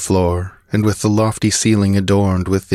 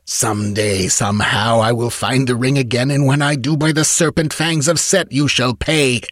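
vengeful vow; 6 semitones higher than usual. A narrator's reading. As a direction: pitch climbing steadily into a high threat. Slave vows revenge on his master.

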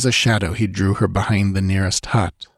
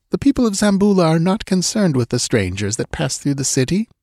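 put-on higher voice, anxious young woman pleading; frightened young woman; 8 semitones higher than usual. A narrator's reading. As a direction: voice the scared dancing girl, pitched up. Girl voiced in story.

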